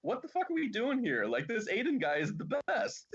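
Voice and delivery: high, exasperated gush; mock outrage. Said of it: "I'm just like".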